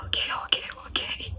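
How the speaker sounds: meek whispered okays to the driver. Retold or imitated. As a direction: whisper meekly in agreement.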